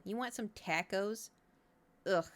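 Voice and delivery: ordinary talk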